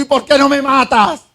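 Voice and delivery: high, half-acted furious challenge to God; a preacher voicing Jonah's mock anger; angry demand. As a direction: toss it up at God in a mock rage. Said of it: "De la misma rabia que le dio".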